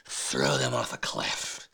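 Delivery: hushed, breathy fury at his enemies; David's angry plea. Retold or imitated. David begging God to punish his enemies, in a hushed furious whisper. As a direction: seethe it out under the breath. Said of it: "He's like".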